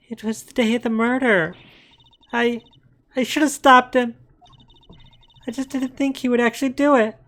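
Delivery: put-on high, pained young woman voice; high tearful witness voice. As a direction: regret breaking through her words. Witness testimony, upset.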